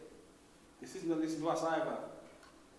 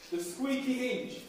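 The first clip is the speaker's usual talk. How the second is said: slow and deliberate, each word spaced out; teacher calling out a line for the class to echo; slow call for repetition; 4 semitones higher, slower than usual. Shown as a demonstration. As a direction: lead the class to repeat it back. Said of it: "I'll say it again".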